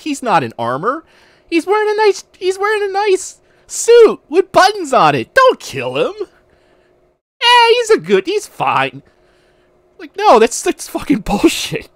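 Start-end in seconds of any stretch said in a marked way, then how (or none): 1.5-9.0 mocking voice. Mocking the game's moral logic. 10.0-11.9 outraged. Real, not acted. Outraged at the game's logic.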